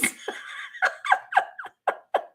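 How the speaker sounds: long rising squeal of laughter; high squealing laugh. Real, not acted. Vlogger losing it over her own joke, squealing laugh. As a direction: let the laugh climb into a squeal.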